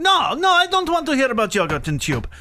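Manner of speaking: high, mock-raging Russian accent. Dad drowning out his daughter's interruptions, raging Russian accent. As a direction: refuse indignantly in a thick Russian accent. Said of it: "this raging Russian man".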